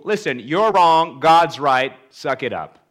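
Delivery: loud, hard lecturing rebuke; mock preachy scold. Friend lectures the grieving with verses, loud mock scold. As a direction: bark it like a know-it-all. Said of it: "Bible verses that tell people".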